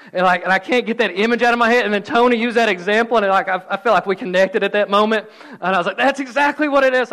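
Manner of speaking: loud, animated rush of enthusiasm; excited. Real, not acted. Preacher recalling Tony's Forrest Gump example, excited. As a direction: burst out with delighted recognition.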